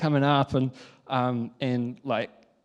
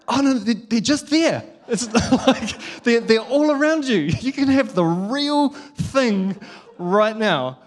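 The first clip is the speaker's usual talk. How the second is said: higher, louder, laughing persuasion; gentle coaxing dad; 8 semitones higher, 6 dB louder, slower than usual. Retold or imitated. Dad pointing his daughter to the real ducks, coaxing. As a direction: coax a toddler away from the screen.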